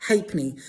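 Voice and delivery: "halfpenny" said HAY-pe-nee, no l or f; halfpenny as haypenny. Shown as a demonstration. Teacher modelling British halfpenny, HAY-penny. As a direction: drop the l and f in "halfpenny". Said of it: "So not halfpenny, it's pronounced".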